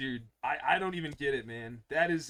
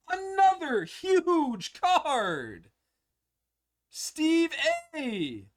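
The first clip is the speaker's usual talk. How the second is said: excited; 10 semitones higher, 5 dB louder, much slower than usual. Real, not acted. Big card pulled, yelling.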